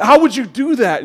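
high, loud mock outrage; angry wife. Wife confronting husband over a dream, acted anger. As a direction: imitate a wife accusing her husband. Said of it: "woke up angry, like, literally angry".

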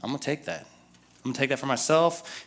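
a mild hushed grab; greedy mutter to himself. Retold or imitated. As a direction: mutter it like a thief grabbing loot. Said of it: "And Achan's like".

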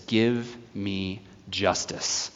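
slow, soft widow's plea; quiet plea. Retold or imitated. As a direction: beg slowly and quietly for justice. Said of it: "she goes and says".

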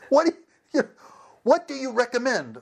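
high, pleading customer; mock exasperation. Customer refused every dish asking for advice, exasperated.

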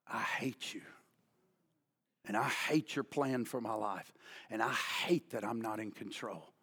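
very low, soft replay of the prayer; subdued, far below preaching volume; hushed regret. Retold or imitated. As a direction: say it almost to yourself. Shamed man replays his prayer.

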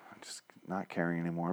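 soft whispered aside; whispering. Real, not acted. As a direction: drop to a breathy whisper.

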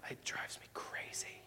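hushed, breathy confession; a soft exasperated whisper; whispered aside. Acted. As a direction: whisper it like a secret. Aside about calm people.

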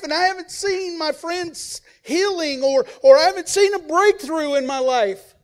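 the jealous son airing grievances; resentful older brother. Acted. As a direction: whine about being overlooked.

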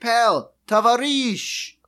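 put-on lightly accented Russian "tavarish", drawn out; a light Russian-accented friendly buddy-up; mock Russian greeting. Podcaster imitating a Russian comedian, light accent. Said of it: "he's like".

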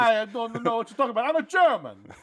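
high, loud, badly faked German accent; wrong German accent. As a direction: ham up a silly German accent. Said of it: "I got their accent wrong".

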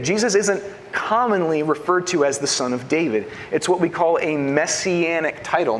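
usual speaking voice

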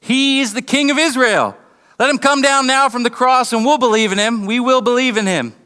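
high-pitched mocking taunt; mock him as a scornful onlooker. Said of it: "mocked him and said".

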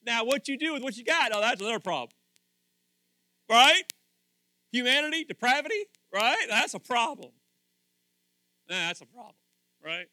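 high, loud rising sermon build; loud preaching climb; preaching at full force. Real, not acted. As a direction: lift the room with every line.

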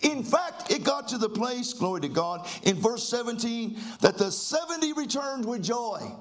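rising, shouting preacher cadence; fiery preaching. Acted. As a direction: preach it with fire to the church. Preacher in full flight.

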